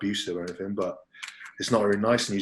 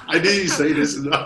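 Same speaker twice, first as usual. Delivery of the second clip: laughing it off, caught out; laughing, caught out by the story; 8 semitones higher, 9 dB louder than usual. Real, not acted. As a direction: bursts out laughing while denying.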